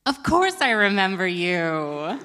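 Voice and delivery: slow, overly bright fake reassurance; badly faked remembering; unconvincing lie. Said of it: "very poor liar".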